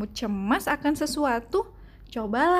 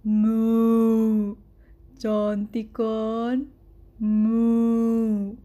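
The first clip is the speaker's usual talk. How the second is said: slow, lower cow voice with a loud moo; 3 semitones lower, 4 dB louder, much slower than usual. A narrator's reading.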